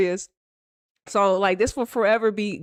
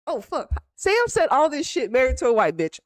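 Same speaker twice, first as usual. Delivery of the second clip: ordinary talk, nothing marked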